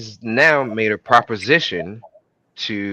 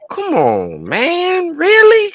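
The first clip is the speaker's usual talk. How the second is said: pleading disbelief; much slower than usual. Acted. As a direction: voice leaping high in protest. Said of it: "he gave it a look like".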